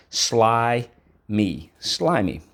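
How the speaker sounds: sly, me, slimy said syllable by syllable; split into syllables. Shown as a demonstration. Teacher sounding out "slimy" for the class. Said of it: "So let's go".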